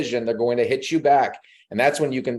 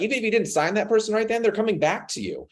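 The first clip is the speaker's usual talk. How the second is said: ordinary delivery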